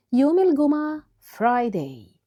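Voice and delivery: ordinary delivery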